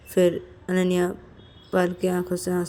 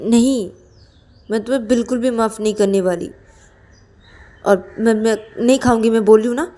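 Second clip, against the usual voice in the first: loud, higher, indignant outburst; angry refusal; 4 semitones higher, 8 dB louder than usual. Acted. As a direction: refuse hotly, raising the voice at a friend. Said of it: "इतना गुस्सा तो मत करो". (गुस्से में ज़ोर से साफ़ इनकार)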